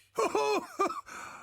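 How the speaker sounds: high rising giddy laugh; high giddy cackle. Acted. Ghost laughs over his recovered locket, giddy cackle. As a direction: cackle with delight.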